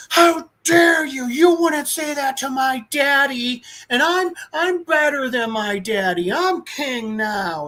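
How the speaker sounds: high, slow, whining mock outrage; indignant kid king. Boy king rebukes his adviser, whiny mock indignation. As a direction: imitate a spoiled child pulling rank. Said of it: "And he's like".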